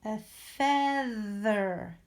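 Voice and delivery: slow feather with the tongue between the teeth; drawn out, tongue on the th of feather; slow exaggerated speech modeling. Shown as a demonstration. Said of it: "I do have my tongue between my teeth when I get to feather".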